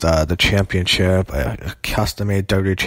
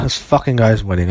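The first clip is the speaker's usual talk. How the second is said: usual speaking voice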